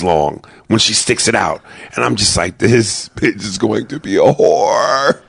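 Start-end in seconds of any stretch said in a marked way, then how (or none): 2.6-5.2 slow appalled mutter. Retold or imitated. Dad watching daughter, appalled.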